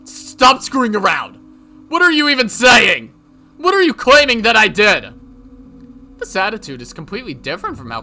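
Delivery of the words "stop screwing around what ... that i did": loud, high, outraged demands; furious shouting. Acted. Yoshimura challenging his accuser, furious. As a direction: demand answers at full volume.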